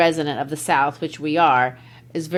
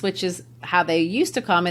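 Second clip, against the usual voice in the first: usual speaking voice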